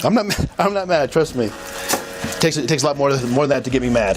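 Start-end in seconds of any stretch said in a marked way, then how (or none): 0.0-1.4 laughing denial. Real, not acted. Officer laughs off accusation.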